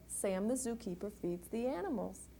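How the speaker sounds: ordinary delivery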